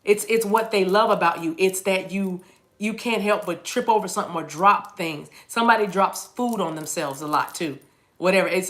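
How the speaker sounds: ordinary talk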